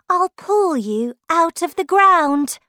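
ordinary delivery, nothing marked

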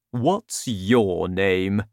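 deep slow friendly giant. A narrator's reading. Giant asks.

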